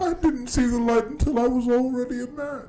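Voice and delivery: high, loud villain delivery; Bane quote. Retold or imitated. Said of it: "How was that not on your top ten".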